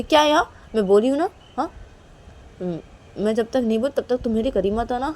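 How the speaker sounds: loud, scolding, indignant; angry refusal. Acted. Angry Reshma scolding her friend Kajal. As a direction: snap back at her for touching the suit.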